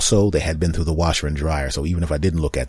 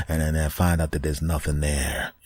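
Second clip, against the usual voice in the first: usual speaking voice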